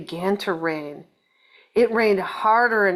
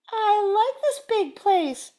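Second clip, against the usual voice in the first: high, slow, delighted bird voice; Missus Bird; 12 semitones higher than usual. Acted. Missus Bird tells her husband she loves it, high and slow. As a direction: pipe it up high like a happy little bird. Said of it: "said Missus Bird".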